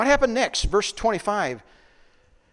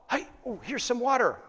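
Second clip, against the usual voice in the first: higher-pitched acted excitement; excited discovery; 7 semitones higher than usual. Thirsty travellers spotting a pool, excited discovery. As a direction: call out a happy find.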